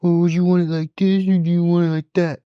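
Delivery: dithering junior Marine. Retold or imitated. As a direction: whine the question like a clueless private. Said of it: "they're like".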